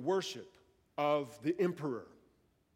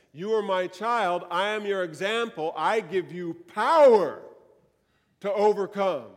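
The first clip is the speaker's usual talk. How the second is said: forceful declaration; 7 dB louder than usual. Acted. Preacher voicing Jesus' promise.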